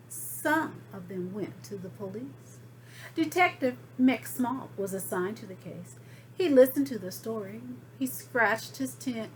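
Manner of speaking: usual speaking voice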